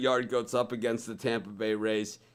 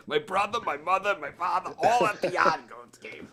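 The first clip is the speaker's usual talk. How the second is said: higher, hammed-up Boston accent with the r's dropped; 7 semitones higher than usual. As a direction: play a joking Boston local, pitched up. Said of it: "in the Boston accent".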